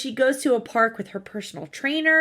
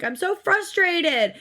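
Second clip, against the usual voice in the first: whiny daughter; 7 semitones higher, slower than usual. Retold or imitated. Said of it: "Hannah's like".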